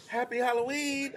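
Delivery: high, soft imitation of wife; wife's greeting. Imitating wife greeting a small girl at the door, high-pitched. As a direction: chirp it sweetly high, imitating her. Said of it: "Wife's at the door".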